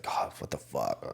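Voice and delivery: low, slow, half-whispered grumble; retold pissed-off grumble. Waking up angry for work, grumbling. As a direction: curse under your breath, imitating your angry self. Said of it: "I'd just be fucking pissed for no reason".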